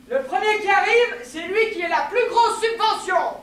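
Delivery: high, loud proclamation ending in a bang; loud announcement. Acted. Announcing the race for the biggest subsidy, loud call. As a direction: call it out like a starter.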